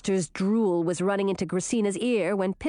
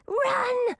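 high-pitched urgent command; urgent cry; 13 semitones higher than usual. A narrator's reading. Snake urges Gracina to escape, urgent cry. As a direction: urge her to flee, pitched right up.

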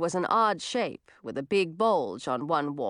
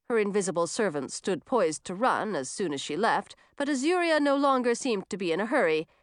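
ordinary delivery

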